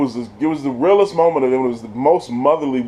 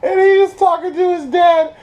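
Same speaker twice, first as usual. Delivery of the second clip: laughing shout; 4 dB louder than usual. Real, not acted. Laughing loud narration.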